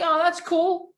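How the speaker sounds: high, breezy mock "oh that's cool"; mock impressed. Shrugging off meeting movie stars, mock impressed. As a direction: brush it off with fake high enthusiasm.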